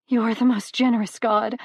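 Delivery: relieved mother praising Hades; grateful gush. A narrator's reading. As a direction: beam your gratitude. Grateful woman.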